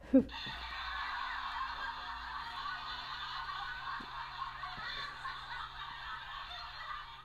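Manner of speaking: long high silent wheeze of laughter; helpless breathless giggle; high wheezing laugh. Real, not acted. Laughing at Noreen's joke.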